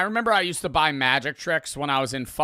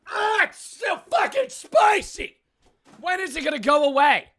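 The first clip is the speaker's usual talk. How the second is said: pained shout; 13 semitones higher, 4 dB louder than usual. Real, not acted. Streamer still burning from the hot chip, real pained yell. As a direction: cry out as the chili burns.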